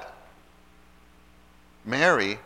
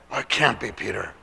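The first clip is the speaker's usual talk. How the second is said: mild disbelief; 3 semitones lower than usual. Acted. Saints doubt the girl's news.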